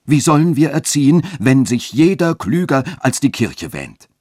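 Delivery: indignant churchman working himself up. A narrator's reading. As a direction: rise into an agitated complaint. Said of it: "sagte der Dechant aufgebracht".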